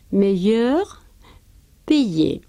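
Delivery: meilleur, payé con el sonido palatal de la elle; French pronunciation. Shown as a demonstration. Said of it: "también delante del palatal". (pronunciación francesa cuidadosa)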